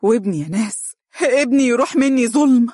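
wailing, rising to a loud high cry. Acted. Father weeping into his hands, loud wailing cry. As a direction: sob it out, climbing to a wail. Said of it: "وهو يبكي هاتفا".

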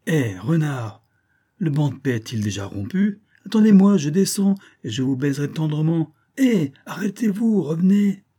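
teasing call, rising high. A narrator's reading. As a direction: call him back from the branch. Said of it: "La mésange lui cria".

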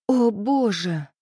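slow, stunned gasp of words; shocked exhale. A narrator's reading. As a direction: draw it out in disbelief. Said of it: "выдохнула шокированно".

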